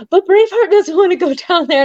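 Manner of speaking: high, lightly voiced worried friend; imitating Brittany. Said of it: "And Brittany's like".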